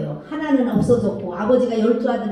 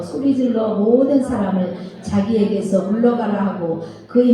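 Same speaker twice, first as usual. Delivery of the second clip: scripture reading; 3 semitones lower than usual. Acted. Teacher reading Genesis aloud to the children, steady scripture reading. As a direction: read the passage out for the class to follow.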